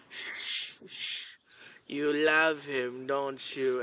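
hushed shushing, then slow, soft coaxing; imitating horse-camp kid. Teller mimicking a boy calming a horse, hushed imitation. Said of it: "realizes how he sounded to me".

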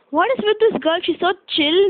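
put-on gently exasperated at the calm girl; amazed granny.

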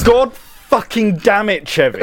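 loud, drawn-out furious curse; angry shout. Acted. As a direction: bellow it at the partner. Cop yells at partner.

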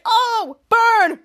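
loud, high mocking cheer; teasing burn. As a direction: crow it like he just got roasted. Teasing after a comeback.

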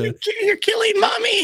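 high laughing shout; hammed-up teasing shout; mock yell. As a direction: yell it to tease him.

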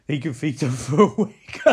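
laughing through the joke. Real, not acted. Podcaster joking about eating Jonah, real laughter. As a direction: crack up mid-sentence.

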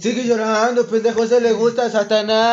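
hammed-up cult taunt; mock sinister taunt. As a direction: taunt the crying kid like a cultist. Imitating cultists taunting.

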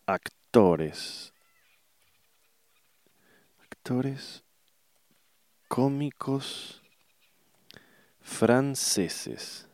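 slow, word by word with long gaps; typing aloud. Real, not acted. Speaker searching online for French comic actors, slow and piecemeal. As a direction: spell out a search as you type, one word at a time.

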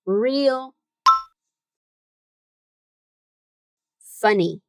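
each word spoken separately with pauses; slow word by word. Shown as a demonstration. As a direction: leave a gap after every word. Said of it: "Say it with me".

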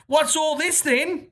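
high mock Cockney bobby; a cheeky stage Cockney line. Reading the email's Cockney line in mock Cockney. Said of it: "Insert more Cockney slash rhyming slang here".

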